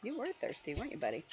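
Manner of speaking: plain reading voice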